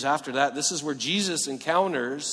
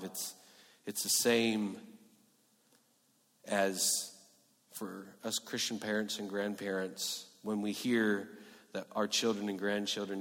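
ordinary talk

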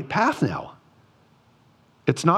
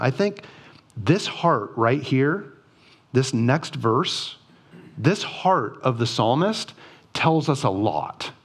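normal talking voice

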